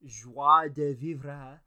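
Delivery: exaggerated French "Joie de vivre"; mock French. Shown as a demonstration. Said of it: "I fucking hate French".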